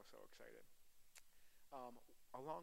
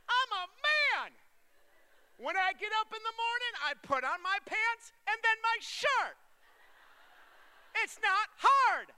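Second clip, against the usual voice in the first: high, very loud comic rant; shouted protest of a baffled dad; exasperated shouting; 20 dB louder than usual. Acted. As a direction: yell it at full volume, indignant and high-pitched. Comic rant about dressing babies.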